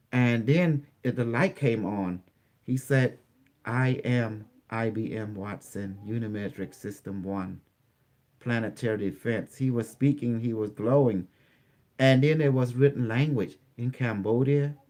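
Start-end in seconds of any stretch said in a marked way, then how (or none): 3.6-9.5 robot voice. Retold or imitated. Robot introduces itself.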